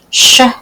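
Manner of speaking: Sh and owed split apart; segmented sounds. Shown as a demonstration. Teacher sounding out showed for children. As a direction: sound out showed in two parts.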